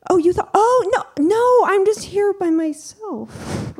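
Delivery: very high-pitched mortified protest; flustered protest. Retold or imitated. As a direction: squeal out an embarrassed denial. Flustered denial of being a hooker.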